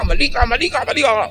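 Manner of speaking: high, heated "you're wrong" jabs; a mock furious retort; angry accusation. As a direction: play the angry accuser snapping back. Angry person accusing you.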